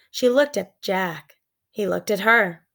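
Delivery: usual speaking voice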